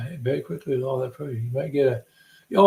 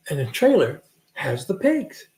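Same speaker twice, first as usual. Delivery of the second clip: usual speaking voice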